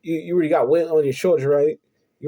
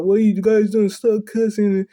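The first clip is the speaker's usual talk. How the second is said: raised, louder retold reprimand; scolding pastor; 6 semitones higher, 4 dB louder than usual. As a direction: imitate a pastor telling kids off. Pastor scolds two fighting kids.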